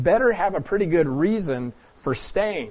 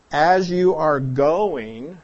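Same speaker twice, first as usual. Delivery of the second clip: very slow, deliberate "As you are going"; slow rendering; 4 dB louder, much slower than usual. Shown as a demonstration. Pastor giving the new translation, slow and deliberate. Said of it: "So you could translate it as".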